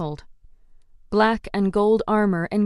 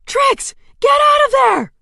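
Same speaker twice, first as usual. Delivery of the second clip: much higher, much louder shout; desperate yell; frantic scream; 8 dB louder than usual. Acted. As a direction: scream the warning too late. Heroine screams a warning.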